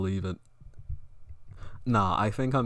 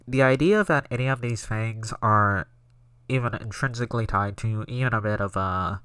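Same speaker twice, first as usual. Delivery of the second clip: loud, put-on nasal voice; nasally impression attempt; 7 dB louder than usual. Streamer attempting a pundit impression, loud and nasal. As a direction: push it loudly through the nose. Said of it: "I gotta get it nasally".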